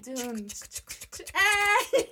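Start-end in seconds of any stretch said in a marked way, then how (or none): 1.3-2.0 loud high call. Real, not acted. Calling out.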